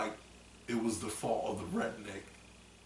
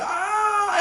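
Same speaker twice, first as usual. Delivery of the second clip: high, loud scream; mock terrified scream; 13 dB louder than usual. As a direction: scream like you're fleeing a chainsaw killer. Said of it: "he's like".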